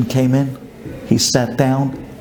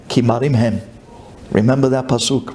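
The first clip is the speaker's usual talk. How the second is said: ordinary talk, nothing marked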